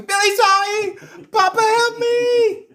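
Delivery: put-on very high, loud, desperate child's scream; childlike screaming. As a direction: shriek for papa like a terrified child. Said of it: "it sounds like a child".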